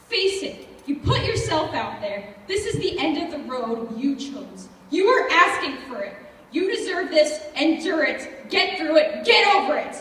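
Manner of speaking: loud, angry self-reproach building to a peak; forceful, punishing commands; harsh self-blame. Acted. Harshly blaming and ordering herself.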